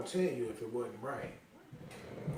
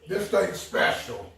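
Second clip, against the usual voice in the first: excited; 5 semitones higher, 9 dB louder, slower than usual. Real, not acted. Guest raving over the food.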